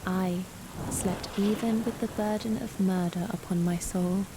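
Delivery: usual speaking voice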